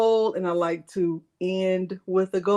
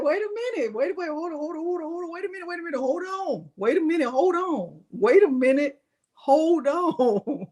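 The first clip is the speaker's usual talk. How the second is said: mock panic; 6 semitones higher, faster than usual. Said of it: "And I'm like".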